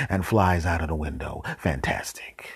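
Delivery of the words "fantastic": whispered dry sarcasm; whispered sarcasm as the last fly escapes. Real, not acted. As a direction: mutter it without voice.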